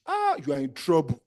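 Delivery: usual speaking voice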